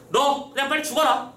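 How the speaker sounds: high, lightly acted threatening command; mock threat. Disguised official to the bound prisoner, mock threatening. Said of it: "지금 협박하고 있는 겁니다".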